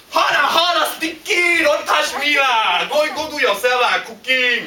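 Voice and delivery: high, loud exasperated scolding; comic outburst, shooing him away; mock irritated wife. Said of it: "And how she react?".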